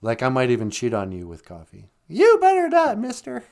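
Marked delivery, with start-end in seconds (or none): 2.1-3.5 high, loud playful scolding; mock jealous warning. Cohost teasing that he'd cheat with coffee.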